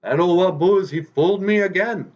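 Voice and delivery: very high, loud indignant fox; fooled fox exclaiming. Acted. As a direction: wail it out, tricked again. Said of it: "And Wagosh goes".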